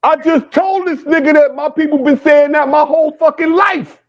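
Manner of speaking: furious that he has to repeat himself; angry outburst. Real, not acted. Heated argument about his family.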